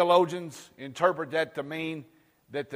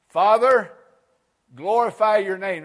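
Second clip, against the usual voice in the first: preaching scripture; 7 semitones higher, 13 dB louder than usual. Acted.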